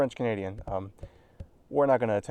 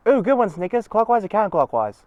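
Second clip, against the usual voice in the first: loud, high cheerful voice; eager approval; 10 semitones higher, 9 dB louder than usual. Acted. Bluey cheering Snickers' circle idea, loud and excited. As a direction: pounce on the idea with delight.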